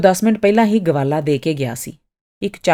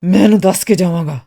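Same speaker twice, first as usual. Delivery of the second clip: loud, defiant outburst; angry; 6 dB louder than usual. A narrator's reading. Artist determined to confront Miss Martha before leaving, angry. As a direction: insist loudly, in anger. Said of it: "ਉਹ ਗੁੱਸੇ ਨਾਲ ਬੋਲਿਆ".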